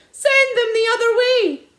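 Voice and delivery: high, loud desperate order; bellow. A narrator's reading. Landowner bellowing orders, furious. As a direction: roar the command.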